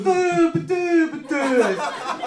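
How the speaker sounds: high, lightly hammed-up babble of an old man; mock deaf old man babbling. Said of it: "he looks back at me and goes".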